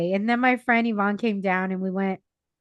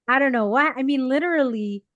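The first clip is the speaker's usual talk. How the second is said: normal talking voice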